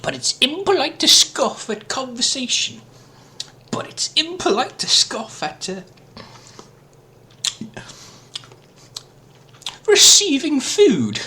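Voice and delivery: high, silly put-on voice. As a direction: pitch it way up like a cartoon. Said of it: "Silly voice man does the voice".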